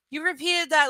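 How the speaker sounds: normal talking voice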